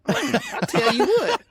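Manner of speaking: high, loud, slow mock sassy woman at the door. Guest doing voices, imitating a sassy woman answering the door. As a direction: play a sassy lady calling toward the door.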